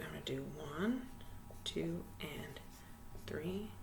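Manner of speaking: soft counting under the breath; whispered counting. Shown as a demonstration. Teacher whispering the beats while writing them. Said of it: "So here's how I'm gonna do it".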